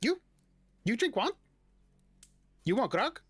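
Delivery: high-pitched kobold in broken speech; high kobold voice, simple questions; kobold barkeep. Acted. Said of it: "the kobold, um, looks at you and he goes".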